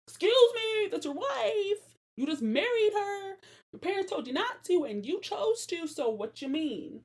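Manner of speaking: high, loud, outraged excuse me; indignant scolding. Real, not acted. Scolding Samson for how he treats his wife, high and indignant. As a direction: call him out in disbelief.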